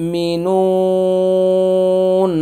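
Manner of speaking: slow, high, drawn-out chanted recitation; Quran recitation. Shown as a demonstration. Teacher showing students the drawn vowel, chanted slowly. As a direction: stretch the nun in melodic tilawat. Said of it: "নুনটা হচ্ছে এক আলিফ টান".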